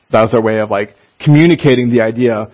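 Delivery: ordinary talk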